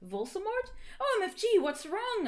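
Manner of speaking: very high, hammed-up alarm; melodramatic, high-pitched dismay; shocked concern. A narrator's reading. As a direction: squeal the question in mock shock. Acted alarmed question in fiction.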